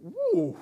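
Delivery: high pained yelp; pained ooh. Real, not acted. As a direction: yelp as the back twinges. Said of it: "That's not good".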